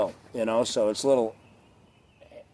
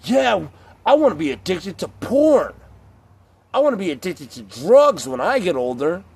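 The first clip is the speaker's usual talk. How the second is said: put-on higher-pitched, emphatic kid's wish; mock excited child; 3 semitones higher, 3 dB louder than usual. Child announcing what to be when older, mock excitement. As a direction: sarcastically cheer like an eager child. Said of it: "when you were a child".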